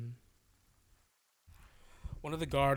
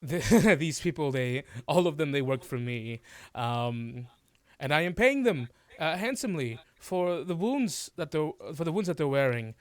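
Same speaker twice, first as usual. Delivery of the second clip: higher, louder fussy author voice; smooth over it like a guilty showman; author excusing himself; 4 semitones higher, 6 dB louder than usual. Acted. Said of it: "he goes".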